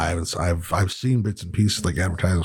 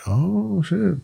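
surprised; 11 semitones higher than usual. Retold or imitated. Reacting to the show's reveal.

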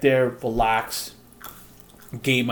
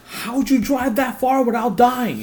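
high, louder retold disbelief; amazed mechanic; 11 semitones higher, 5 dB louder than usual. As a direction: imitate the mechanic marvelling. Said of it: "And they're like".

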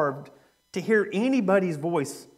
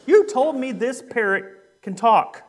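higher, louder acted indignation; furious customer; 5 semitones higher, 4 dB louder than usual. Furious widow confronting the pet shop owner, indignant. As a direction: accuse the shop owner in mock fury. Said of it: "She's furious".

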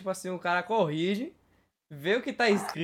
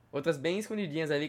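ordinary delivery

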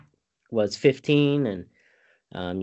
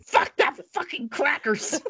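strained falsetto holler on the edge of laughter; imitated crazed yell; 13 semitones higher than usual. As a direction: squeal it like a madman, cracking up. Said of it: "he yells".

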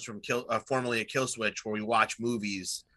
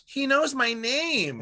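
put-on kid voice; 12 semitones higher, 3 dB louder, slower than usual. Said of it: "the kid's like".

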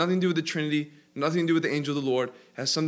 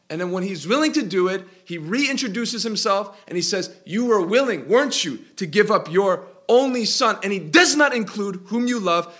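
louder, higher sermon voice building in fervor; full preaching; 5 semitones higher, 6 dB louder than usual. Acted. Pastor pressing the point to his church, fervent preaching. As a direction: preach it out to the congregation.

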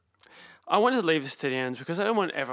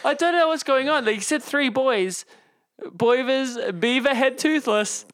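broad outback Australian drawl, slow and louder; mock Aussie country accent; 4 semitones higher, 3 dB louder than usual. Host reading a listener's email to cohost in mock Australian country accent. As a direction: read the email like an outback bloke, hammed-up. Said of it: "sort of out in the country accent".

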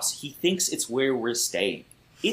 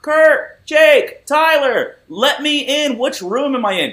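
high, loud calling through hotel doors; 13 semitones higher, 8 dB louder, slower than usual. Retold or imitated. Lost drunk friend calling for his buddies, loud. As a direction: yell names down the hallway, lost.

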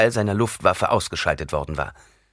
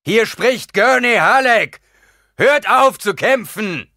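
slow, much higher and louder shout; calling out through cupped hands; 9 dB louder, slower than usual. A narrator's reading. Gurney shouts to his men through cupped hands, slow and loud. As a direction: call far across the battlefield. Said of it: "Handflächen trichterförmig an den Mund".

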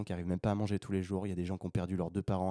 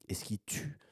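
dramatic whisper; hushed, confiding whisper; 5 dB quieter than usual. Real, not acted. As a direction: lean in and whisper it. Dramatic emphasis on key point.

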